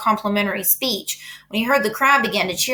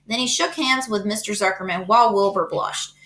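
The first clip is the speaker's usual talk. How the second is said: plain reading voice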